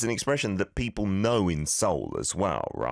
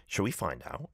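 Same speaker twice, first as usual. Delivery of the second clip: ordinary delivery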